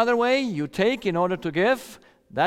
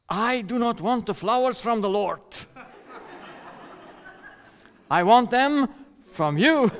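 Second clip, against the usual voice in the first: lightly voiced wife, rising and laughing at the end; indignant wife; 6 semitones higher than usual. Wife refusing flowers credited to the Lord, mock huffy.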